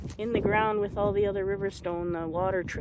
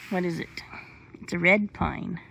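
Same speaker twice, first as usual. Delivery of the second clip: ordinary talk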